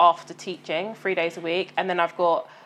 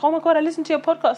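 excited acquaintance; 8 semitones higher, faster than usual. Retold or imitated. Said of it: "and like".

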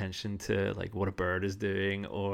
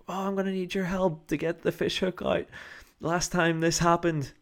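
high, loud call across the pier; old lady calling out; 9 semitones higher, 4 dB louder than usual. Retold or imitated. Older lady calling the walkers over, high calling voice. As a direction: summon the passers-by for help. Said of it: "she starts shouting over to us".

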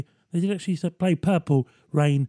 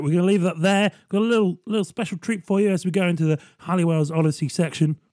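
ordinary talk

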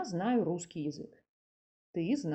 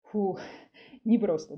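exhale, then a short laugh through the words; light, laughing sigh of relief; relieved laugh; 5 dB louder than usual. Real, not acted. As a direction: puff out in mock exhaustion, chuckling. Relief after hard explanation.

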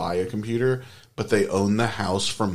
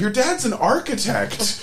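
high, drawn-out exasperation; hammed-up indignant squawk; incredulous protest; 4 dB louder, slower than usual. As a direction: pitch it way up in disbelief.

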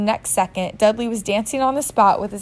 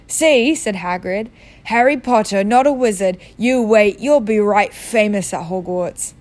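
big, louder rustic giant voice, earnest; Hagrid voice; 4 dB louder than usual. Acted. Hagrid explains Voldemort's vanishing to Harry, loud rustic giant. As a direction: tell it like a gruff big-hearted giant.

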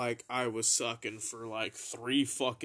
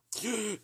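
gasp; 9 semitones higher than usual. Retold or imitated. Gasping after hit.

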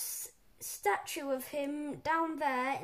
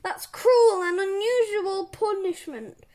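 loud, raised wail of injustice; indignant protest; 5 semitones higher, 10 dB louder than usual. A narrator's reading. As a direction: cry foul at the principal. Boy protests the punishment.

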